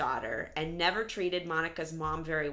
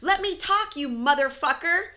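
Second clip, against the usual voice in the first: higher, louder mock furious yell; hammed-up yell from an angry mother; mom's outburst; 9 semitones higher, 6 dB louder than usual. Podcaster imitating the mother swearing at her daughter, mock furious yell. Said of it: "And then her mom says".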